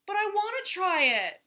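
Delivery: put-on high, loud childish whine; whining. A narrator's reading. Turtle begs Vulture for the bracelet, whining. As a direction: whine it, pitched high and insistent. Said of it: "whined Turtle".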